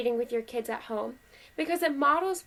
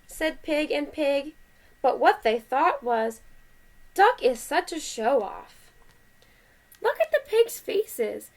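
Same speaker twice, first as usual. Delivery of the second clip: lively, measured picture-book reading; animated read-aloud with well-marked phrases; story read to children; 4 dB louder, slower than usual. A narrator's reading. Parent reading Duck on a Bike to kids, animated story voice.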